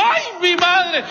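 high, drawn-out exclamation; hammed-up cry of dismay; mock dismay. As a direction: wail it slowly in comic alarm. Retold dismay at cold shower.

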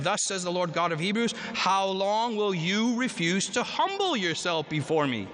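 ordinary talk, nothing marked

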